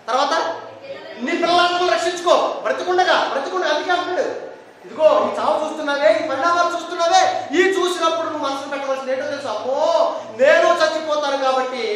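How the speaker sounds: urgent preaching. Acted. Preacher urging listeners to save their children, urgent preaching. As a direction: press the warning home to the listeners.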